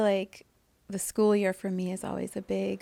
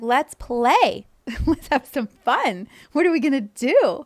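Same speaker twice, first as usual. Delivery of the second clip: loud bright call, louder; excited cheer; 5 semitones higher, 9 dB louder than usual. Retold or imitated. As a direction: call it out to the crowd with joy. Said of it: "he's like".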